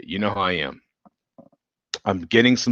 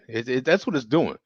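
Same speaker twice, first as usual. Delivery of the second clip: ordinary talk, nothing marked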